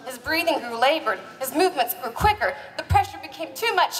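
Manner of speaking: normal talking voice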